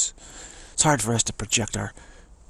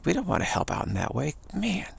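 high pleading dog voice, much higher; 9 semitones higher than usual. Retold or imitated. Dog begging the Apex Yogins for rescue, high pleading voice. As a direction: squeak it up like a desperate little dog begging. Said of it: "He says".

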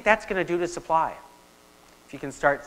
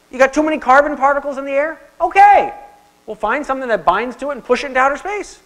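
higher and louder, brash can-do confidence; gung-ho tech optimist; 6 semitones higher, 5 dB louder than usual. Retold or imitated. Lecturer mimics Silicon Valley boasting to the audience, brash and excited. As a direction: play Silicon Valley promising to fix everything. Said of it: "Silicon Valley saying".

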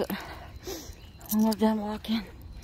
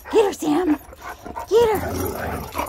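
high, loud egging on; excited urging; 9 semitones higher, 3 dB louder, slower than usual. Real, not acted. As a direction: cheer the dog on. Urging dog to chase.

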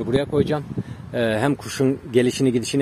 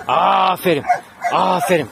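long drawn-out, higher praise; raised, encouraging well-done; praising the dog; 4 semitones higher, 5 dB louder, slower than usual. Real, not acted. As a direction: cheer the dog on with stretched vowels. Praising dog during training.

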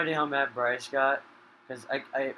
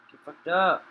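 taunting; 4 semitones higher, 7 dB louder than usual. Real, not acted. Taunting an eaten opponent.

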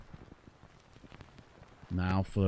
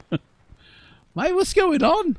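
much higher, with a disbelieving laugh; incredulous squeak; 7 dB louder, much slower than usual. Real, not acted. Baffled by game restriction.

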